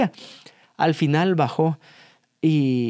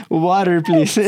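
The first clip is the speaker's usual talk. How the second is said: Water, please repeated a bit louder, through laughter; amused repeat of the child's English; 4 dB louder, much slower than usual. Retold or imitated. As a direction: replay the kid's line with glee.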